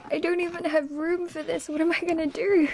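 high, real happy fluster; overwhelmed delight. Real, not acted. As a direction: wail it high in delighted dismay. Overwhelmed, no room for poster.

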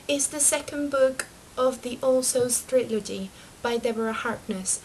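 usual speaking voice

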